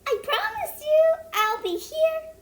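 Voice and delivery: squeaky high puppet voice, bouncy; a cartoon pig popping up to greet kids; Piggy puppet voice. Puppet pig greeting the young viewers, very high and squeaky.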